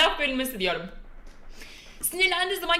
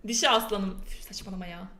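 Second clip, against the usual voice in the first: normal talking voice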